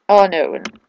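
normal talking voice

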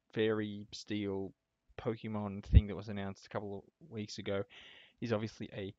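usual speaking voice

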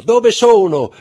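very high, loud, delirious with joy; ecstatic cry. A narrator's reading. Mother cries out with joy as her son wakes. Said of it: "gridò".

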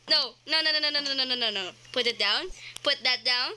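ordinary talk, nothing marked

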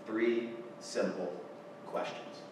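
three simple, slowed right down, fading to a breath; slow, hushed closing line. Real, not acted. Speaker closes his eulogy story to the audience, slow and hushed. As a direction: let the last words drop almost to a whisper.

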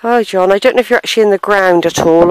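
usual speaking voice

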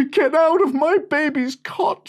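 high, loud mother's scream. Acted. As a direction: shriek to drive them away. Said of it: "she screamed".

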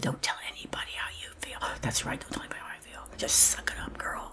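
faint, distant whispered voice; a ghostly whisper from inside; inner voice. Retold or imitated. An inner voice warning her to stay silent, in a faint whisper. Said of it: "And this voice said".

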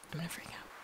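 breathless whisper of excitement. Real, not acted. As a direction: whisper it, barely containing it. Said, then whispered.

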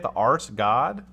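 "The Ars God?" said slowly like two words, ars then god; mock Ars God. Shown as a demonstration. Host jokes about the name as the Ars God, slowly. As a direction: stretch out "Ars God" as a joke reading. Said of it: "Is that how you say it?".

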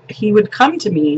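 normal talking voice

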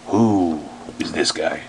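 ordinary talk

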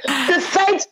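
high, mock outraged delight; playful mock indignation at the Fed; mock exasperation. Mother teasing the Fed with mock exasperation. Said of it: "It was like".

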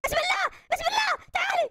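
a high, quick shouted call; startled shout. Pretend. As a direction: call after her in a hurry. Alarm as doll leaves. (صيحة مفاجأة عالية وسريعة)

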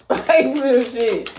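laughs through a high, loud "Like, real shit"; cracking up mid-warning; real laughter on the words; laughing. Real, not acted. Laughing at her own warning.

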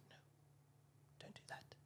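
very soft, near-whispered; hushed retold advice. Audience members advising after opening night, hushed imitation. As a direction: imitate someone discreetly warning you off.

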